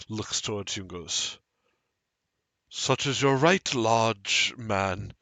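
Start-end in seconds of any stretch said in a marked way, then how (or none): 2.7-5.1 a priest's warm, raised voice, louder; kindly priest offering bread, louder. Acted. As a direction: speak as a generous robed priest offering food.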